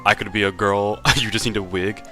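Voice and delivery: normal talking voice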